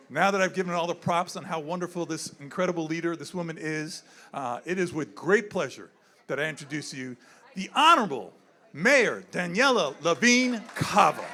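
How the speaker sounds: usual speaking voice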